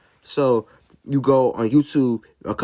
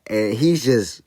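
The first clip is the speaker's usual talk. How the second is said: ordinary delivery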